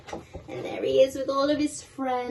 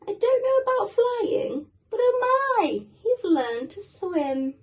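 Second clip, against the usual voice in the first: higher, fond mother voice; mummy penguin; 12 semitones higher than usual. Acted. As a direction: marvel gently at the little one. Mummy penguin answers her chick.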